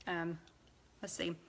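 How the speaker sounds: talking while licking fingers; words around licking fingers. Real, not acted. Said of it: "Sorry for licking my fingers".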